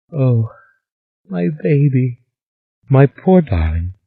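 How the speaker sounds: mild tearful lament over the body; grieving. A narrator's reading. Mother grieves dead daughter.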